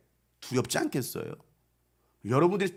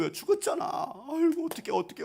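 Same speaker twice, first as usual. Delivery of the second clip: lament; 9 semitones higher, 4 dB quieter than usual. Retold or imitated. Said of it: "막 난리가 난 거예요".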